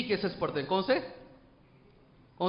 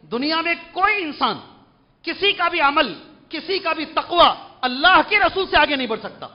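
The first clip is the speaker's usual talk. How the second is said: forceful preaching; 8 semitones higher, 7 dB louder than usual. Acted. Emphatic preaching point.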